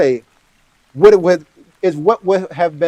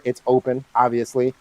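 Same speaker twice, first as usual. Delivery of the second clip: ordinary delivery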